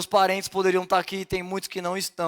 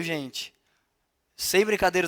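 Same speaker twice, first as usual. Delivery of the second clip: normal talking voice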